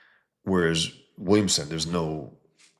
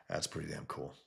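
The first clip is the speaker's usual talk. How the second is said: usual speaking voice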